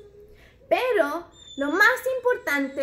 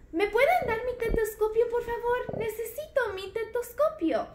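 higher, quicker little doctor voice; puppet doctor voice; 7 semitones higher than usual. Pretend. Toy doctor asking the children for her stethoscope, high puppet voice.

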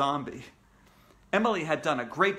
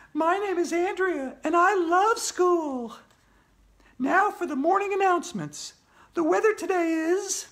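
Andrea, eager announcer; 14 semitones higher, 4 dB louder than usual. Acted. Andrea opening morning announcements, high eager presenter.

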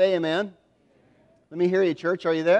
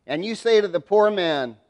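usual speaking voice

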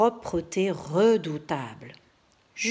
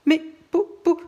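high, loud stuttering pourquoi; a boy stammering in shock, much higher; stammering panic; 10 semitones higher, 6 dB louder than usual. Acted. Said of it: "demanda Jules".

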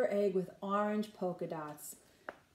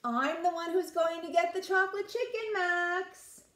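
higher, smug big sister gloating; 8 semitones higher than usual. A narrator's reading. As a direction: gloat over the prize. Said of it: "Ruby counted her eggs".

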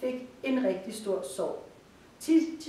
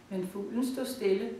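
plain reading voice

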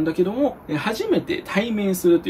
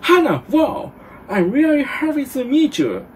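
high, gushing mock delight; hammed-up excitement; 9 semitones higher, 4 dB louder, much slower than usual. Shown as a demonstration. Teacher playing Jean greeting Hannah, theatrically thrilled. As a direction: overplay the joy of meeting a classmate. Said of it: "感情込めないといけませんか？".

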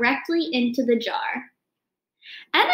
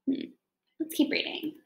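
soft aside; 10 dB quieter than usual. Real, not acted.

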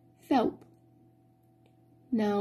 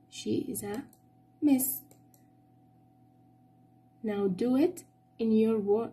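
ordinary delivery

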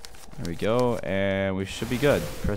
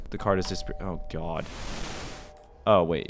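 ordinary talk, nothing marked

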